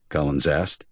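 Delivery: usual speaking voice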